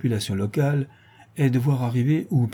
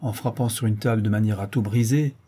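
ordinary delivery, nothing marked

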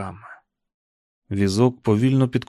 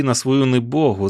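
ordinary delivery, nothing marked

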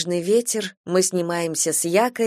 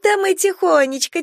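sweet reassuring; 12 semitones higher, 3 dB louder than usual. Acted. Stranger reassures, word repeated.

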